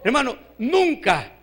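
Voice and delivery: normal talking voice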